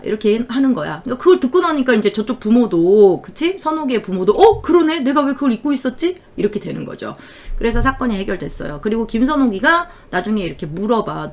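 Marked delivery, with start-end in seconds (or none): 4.3-6.2 sudden realization. Retold or imitated. Parents suddenly realize the truth.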